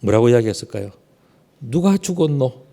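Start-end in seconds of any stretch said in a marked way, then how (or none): 1.6-2.7 Gyeongsang dialect. Retold or imitated. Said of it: "그래서 경상도 말로".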